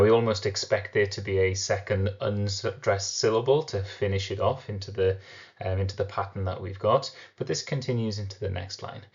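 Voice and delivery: ordinary talk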